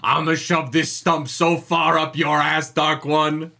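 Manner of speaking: slow, hammed-up battle threat building to a shout; drawn-out mock-heroic roar; Rand's dramatic speech. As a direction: declaim it like an over-the-top hero defying his enemy. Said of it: "dramatic reading of Rand's speech".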